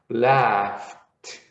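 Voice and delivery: "laughed" with an f sound and a final t; careful model. Shown as a demonstration. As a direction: model "laughed" slowly for the class. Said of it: "you would pronounce that in past as".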